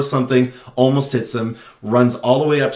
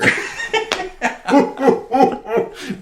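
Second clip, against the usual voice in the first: Bowser; 13 semitones higher than usual. Retold or imitated. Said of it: "he laughs like Bowser".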